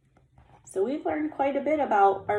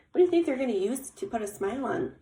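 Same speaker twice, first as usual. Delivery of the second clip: ordinary delivery, nothing marked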